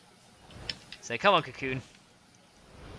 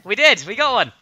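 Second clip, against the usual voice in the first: excited; 9 semitones higher, 11 dB louder than usual. Real, not acted. Celebrating the new villager.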